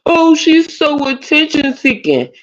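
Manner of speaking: high, slow mocking voice, stretched out; mocking whiny quote. Mocking the men who call her attention seeking, high slow voice. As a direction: drag it out like a whiny complainer.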